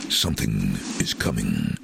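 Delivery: low, ominous film-trailer read; dramatic low trailer voiceover; movie trailer voice. Acted. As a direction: announce a blockbuster, deep and portentous. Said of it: "the first line was".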